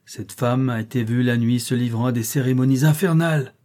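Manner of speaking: plain reading voice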